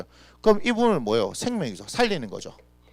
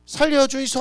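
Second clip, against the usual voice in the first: desperate cry for help; 9 semitones higher, 4 dB louder than usual. Acted. Disciples crying out in storm.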